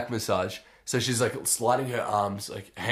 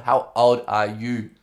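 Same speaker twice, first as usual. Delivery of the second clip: slow, louder spelled-out question; imitated insistent masseuse; 6 dB louder, slower than usual. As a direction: stretch each word out as the masseuse, loudly. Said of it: "shaking her head, laughing, like".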